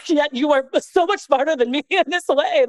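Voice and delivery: high, laughing through the line; real laughter breaking in. Real, not acted. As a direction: giggle as you admit it. Laughing at her brother's wisdom.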